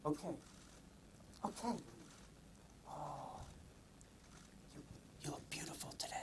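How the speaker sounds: soft whisper to the groundhog. Real, not acted. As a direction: whisper it tenderly.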